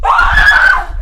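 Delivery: high shriek with a laugh; startled shriek. Acted. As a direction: yelp in surprise.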